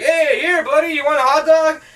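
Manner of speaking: very high, put-on calling out; mock friendly offer. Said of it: "and he's like".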